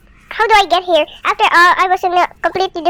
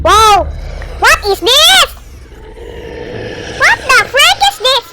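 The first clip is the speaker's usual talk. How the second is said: spooked; 7 semitones higher, slower than usual. Real, not acted. Spooked by game sound.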